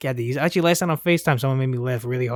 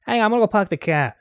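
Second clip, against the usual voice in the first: higher, hammed-up Boston accent; 7 semitones higher than usual. Co-host shows off his Boston voice, exaggerated Boston accent. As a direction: put on a thick Boston car-parking accent. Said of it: "I'm Boston".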